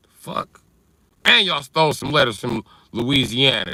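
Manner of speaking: ordinary talk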